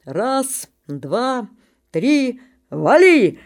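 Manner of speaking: father calling the jump; громкий отсчёт; shouted countdown. Acted. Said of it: "Отец кричит".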